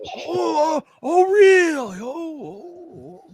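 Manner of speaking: put-on high, wavering old man voice; a high ninety-four-year-old going oh, oh; dazed old man. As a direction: play Fred, baffled and sheepish. Fred voiced reacting.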